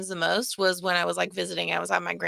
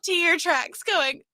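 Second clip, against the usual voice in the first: high, squeaky words through helpless laughter; laughing while talking; 12 semitones higher, 5 dB louder, much slower than usual. Real, not acted. Retelling the reel to friends, cracking up laughing. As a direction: barely get it out for laughing. Laughing hard at own joke.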